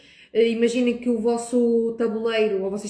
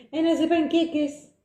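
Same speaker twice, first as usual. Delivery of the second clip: put-on higher, slow sing-song kid talk; 7 semitones higher than usual. Shown as a demonstration. Host mocking how adults talk to kids, higher sing-song. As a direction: coo it like talking to small children. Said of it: "Por que nós falamos assim com os miúdos? Parecemos parvos".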